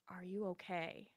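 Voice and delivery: soft, barely voiced question; mouthed question. Flight attendant secretly checking on the passenger, soft mouthed question. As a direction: mouth it silently to her. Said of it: "she mouths".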